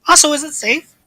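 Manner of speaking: high, worried character voice; high nervous character voice. Acted. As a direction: fret upward like a nervous scholar. Same character voice.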